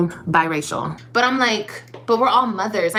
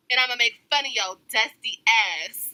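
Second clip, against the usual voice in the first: normal talking voice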